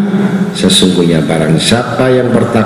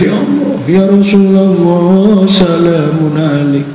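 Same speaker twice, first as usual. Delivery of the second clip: high, slow weeping invocation; hammed-up crying salawat; mock tearful prayer; 9 semitones higher, slower than usual. Weeping worshipper calling on the Prophet, high mock sob. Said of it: "Orang yang nangis".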